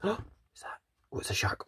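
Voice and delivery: hushed gasp and whisper. Acted. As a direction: whisper as if spotting danger. Gasp then hushed discovery.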